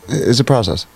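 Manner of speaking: ordinary talk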